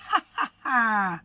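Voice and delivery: villainous chuckle; sly thieves' laugh. Acted. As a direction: laugh like pleased crooks. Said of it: "The two thieves chuckled".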